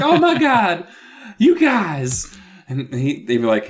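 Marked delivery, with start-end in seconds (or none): 0.0-2.3 high, loud gushing; hammed-up joy at seeing his parents; overjoyed. Boy hugging Mom and Dad, high and gushing. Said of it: "He's like".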